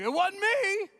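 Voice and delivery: high, loud mock denial; crowd denying. The crowd denies touching Jesus, mock innocent.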